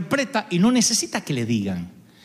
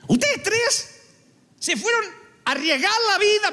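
scolding king; 11 semitones higher than usual. Acted. David scolding the three.

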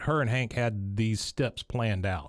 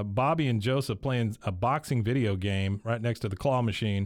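normal talking voice

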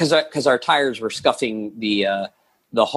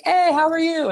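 mock cheerful greeting. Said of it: "She's like".

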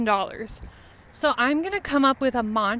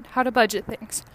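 normal talking voice

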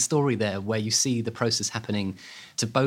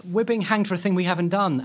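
higher-pitched, lightly acted plea of innocence; mock protest; 8 semitones higher, 4 dB louder than usual. Condemned twins protest on the way to hanging, aggrieved. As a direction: imitate an aggrieved condemned man, pitch raised.

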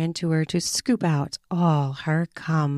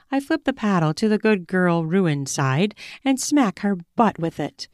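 plain reading voice

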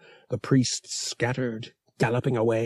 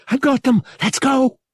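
high, loud shout to the others; urgent triumphant shout from below; excited call; 6 dB louder than usual. Acted. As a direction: yell it out across the ship. Bria calling from ship's depths.

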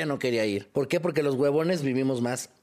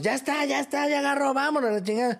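excited group shout; 11 semitones higher than usual. Retold or imitated.

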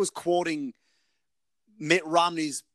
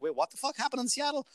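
high, quick, softer baffled question; baffled surprise; 8 semitones higher, 6 dB quieter, faster than usual. Retold or imitated. Retelling his reaction to a podcast, baffled. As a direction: act out being caught off guard, fast and high.

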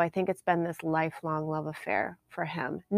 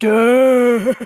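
loud, higher, drawn-out mock-dazed "duh"; dopey smitten noise; 6 semitones higher, 15 dB louder than usual. Fan retelling her reaction to the actor, comically dumbstruck. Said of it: "And I was like".

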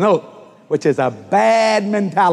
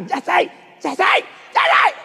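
shout; 13 semitones higher, much slower than usual. Retold or imitated. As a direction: yell his name to stop him. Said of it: "I hear Kathy".

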